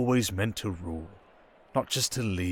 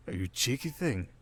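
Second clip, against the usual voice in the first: usual speaking voice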